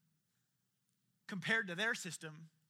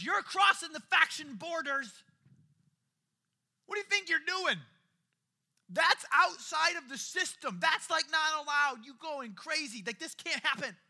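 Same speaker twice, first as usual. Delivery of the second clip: higher, louder scandalised protest; shocked woman; 7 semitones higher, 9 dB louder than usual. Retold or imitated. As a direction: sound aghast he'd even ask. Samaritan woman shocked at Jesus.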